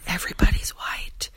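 hushed whisper; whispered aside. Real, not acted. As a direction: whisper it like a secret. Aside about the crowd.

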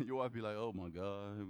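low, hushed wifely groan; imitating a fed-up wife, quietly; muttered exasperation. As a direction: mutter it under your breath, very low. Mimics wife reacting to loud husband.

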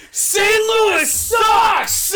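high, drawn-out stadium shout; heckling yell. Retold or imitated. Fans heckling the visiting pitcher, drawn-out yell. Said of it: "like, yell [name]".

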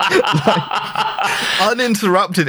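high, helpless laughter through the words; laughing hard. Real, not acted. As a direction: crack up while getting it out. Cracking up over the alarm.

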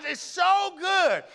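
high, loud, slow drawn-out rave; husband raving about wife's cooking; ecstatic food moan. Retold or imitated. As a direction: savor every word. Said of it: "She's like".

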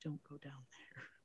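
hushed to herself, barely audible; very quiet. Retold or imitated. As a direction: murmur it to yourself in dread. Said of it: "very quietly says to herself".

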